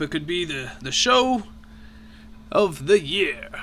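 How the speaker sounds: put-on a jolly old dragon headmaster; booming voice. Dragon headmaster keeps speaking.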